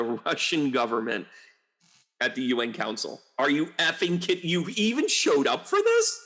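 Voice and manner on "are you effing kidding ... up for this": higher and higher, incredulous outrage; outraged disbelief. Real, not acted. Scolding Russia's UN diplomats, rising outraged disbelief. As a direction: climb in pitch, can't believe it.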